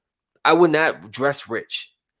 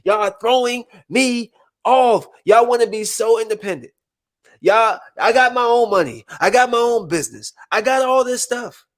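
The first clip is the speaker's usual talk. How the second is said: mock independent woman; 8 semitones higher than usual. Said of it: "I just don't understand women".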